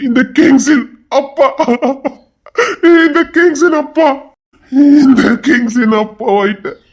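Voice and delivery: joyful crying; tearful joy. Acted. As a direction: sob out the news happily. New father overwhelmed.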